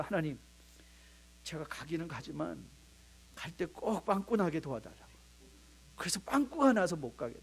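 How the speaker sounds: a lightly voiced timid youth praying; sheepish prayer. Young man's sheepish prayer. (소심하게 속삭이듯 하는 기도 흉내)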